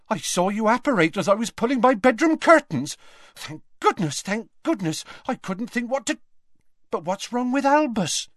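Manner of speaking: high, fast breathless fretting woman; flustered landlady. Acted. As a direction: rattle it off in a fluster. Rosmerta flustered, rushing in.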